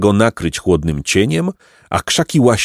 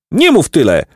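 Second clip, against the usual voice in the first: put-on sharp high shout at the old man; 9 semitones higher, 4 dB louder than usual. Jacek shouting at the old man to stop talking. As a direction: snap it out, fed up. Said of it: "krzyknął Jacek".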